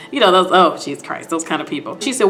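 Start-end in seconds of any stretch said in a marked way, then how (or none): none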